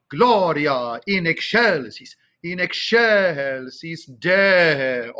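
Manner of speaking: slow choral "excelsis Deo" with long SHELL and DE; emphasis on shell and de. Shown as a demonstration. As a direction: stretch ek-SHELL-sis and DEH-o, weight on both. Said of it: "Emphasis on the shell and the de".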